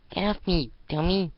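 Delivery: put-on high, loud childish retort; bratty snap. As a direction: shake her off, annoyed. Girl shakes off sister.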